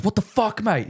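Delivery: mock outrage from a golfer nearly hit, high and squeaky; angry golfer. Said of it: "the guy goes".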